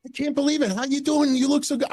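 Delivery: mock excited fan greeting. Slash spots him and gushes a fake hello, high put-on voice. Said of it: "He's like".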